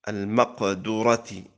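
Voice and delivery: المقدرة said with "u" after the dal, maqdurati; damma on the dal. Shown as a demonstration. Teacher listing a word's three vowelings, damma on the dal of المقدرة. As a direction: sound المقدرة as maq-DU-ra. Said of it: "والكسر والضم".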